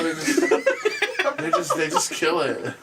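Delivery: usual speaking voice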